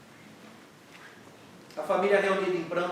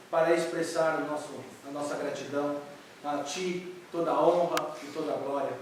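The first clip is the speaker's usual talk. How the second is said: earnest prayer; 4 semitones lower than usual. Real, not acted. Pastor leading the church in prayer, reverent. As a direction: pray sincerely for the congregation.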